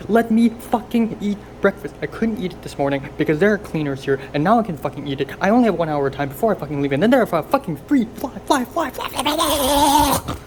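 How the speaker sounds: loud high furious ranting; screaming rant. Real, not acted. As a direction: yell it all out in frustration. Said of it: "I've screamed so much".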